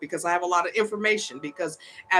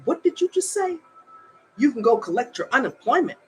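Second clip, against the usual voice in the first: much higher, incredulous demand; stunned disbelief; 5 semitones higher than usual. Retold or imitated. Retelling her confrontation with the woman, incredulous. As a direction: replay the outraged question, pitch jumping up. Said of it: "I said".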